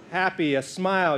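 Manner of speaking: ordinary delivery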